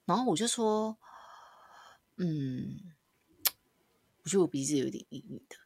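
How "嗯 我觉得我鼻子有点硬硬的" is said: soft whisper. Retold or imitated. Retelling telling teacher softly.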